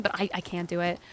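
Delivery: ordinary talk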